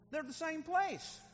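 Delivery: high-pitched, slow, preaching hard; emphatic insistence. Real, not acted. Preacher telling the congregation both words match, high and emphatic. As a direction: drive it home slowly, pitched right up. Preacher drives the point home.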